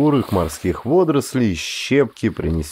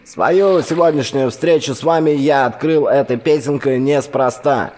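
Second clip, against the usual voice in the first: high, bright-paced radio host patter; breezy on-air banter through a radio; chatty radio DJ; 5 semitones higher than usual. A narrator's reading. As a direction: rattle it off like a DJ talking to night listeners. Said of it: "объявил диджей из динамика".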